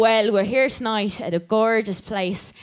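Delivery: put-on light Irish accent, cheerful host. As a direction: chat like a lively Irish host. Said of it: "do your Irish accent".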